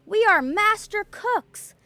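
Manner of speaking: loud, high call from the well; proud loud announcement from the well; shouted. A narrator's reading. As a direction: shout it out to the whole town. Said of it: "climbed up on the edge of the well and shouted".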